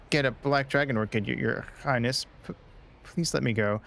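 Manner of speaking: normal talking voice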